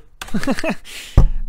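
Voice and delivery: short loud laugh. Real, not acted. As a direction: burst out laughing. Laughs before wrap-up.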